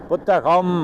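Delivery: புத்தகம் with a long held "m" at the end; final consonant shown. Shown as a demonstration. Teacher asking students whether they hear the consonant, held final sound. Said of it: "இம் இருக்கா".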